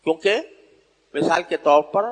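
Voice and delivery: ordinary delivery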